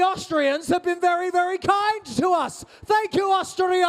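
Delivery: high, slow shout of thanks to the crowd; a rousing cheer to the audience. Real, not acted. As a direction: call it out big and grateful.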